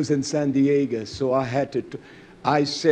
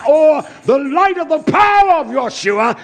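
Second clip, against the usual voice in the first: loud, high, rising shout; exultant shout; 13 semitones higher, 8 dB louder than usual. Acted. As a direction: raise the roof on power. Climax of sermon, celebrating.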